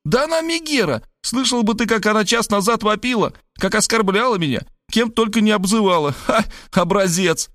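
very high outraged exclamations; flaring up. A narrator's reading. Varvara flaring up at Rob over her mother, very high. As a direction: shoot up in pitch, outraged. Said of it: "Варвара взвилась".

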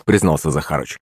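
ordinary delivery, nothing marked

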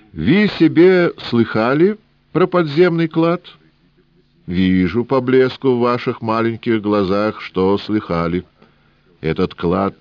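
put-on high, sing-song Yiddish-accented old man; wheedling old trader with Yiddish lilt.

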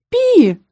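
high, loud uncertain squeak; ambiguous mouse squeak. A narrator's reading. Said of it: "неоднозначно ответил мышь". (неопределённый мышиный писк)

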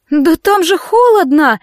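high, drawn-out wail; wailing protest. A narrator's reading. Veronika balking at jumping into the sea, wailing protest. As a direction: whine it high and slow. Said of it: "взвыла Вероника".

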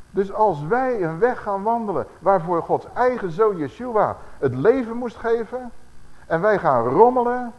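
slow, loud, driving sermon voice; forceful preaching. Acted. Preacher warning the church about wandering, loud and slow. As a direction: preach it out slowly and loud to the congregation.

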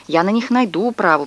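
plain reading voice

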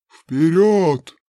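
loud, forceful order. Acted. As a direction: yank him forward with your voice. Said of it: "натягивая невидимые поводья". (громкий властный приказ)

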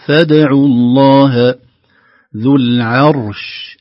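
"العرش" with a light ain and a heavy ra, slow and loud; thin the ain, thicken the ra in "العرش"; tajweed recitation. Shown as a demonstration. Teacher reciting the phrase slowly. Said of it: "العرش نرقق العين".

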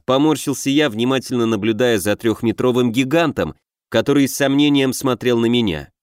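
ordinary delivery, nothing marked

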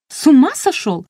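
sharp, high indignant cry; outraged retort. A narrator's reading. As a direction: scold him in disbelief. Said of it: "возмутилась я".

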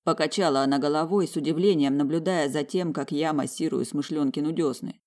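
plain reading voice

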